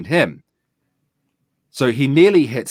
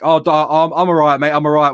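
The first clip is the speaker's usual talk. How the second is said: higher, louder insistent reply; raised-voice brush-off; imitated flustered driver; 6 semitones higher, 6 dB louder than usual. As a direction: mimic a driver calling back that he's fine.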